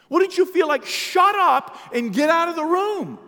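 high, loud, drawn-out indignation; outraged shout. Acted. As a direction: throw the intruder out at full voice. Voicing outraged mourner's reaction.

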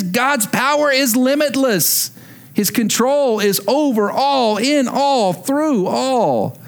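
building sermon declaration; preaching. Acted. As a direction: proclaim it to the congregation. Preaching God's limitless power.

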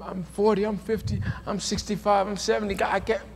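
ordinary talk, nothing marked

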